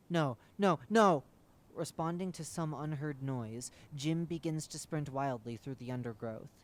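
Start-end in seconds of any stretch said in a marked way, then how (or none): 0.1-1.2 rising terrified shout; loud terrified no; panicked no. Acted. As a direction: panic building into a scream. Jim panics at the voice.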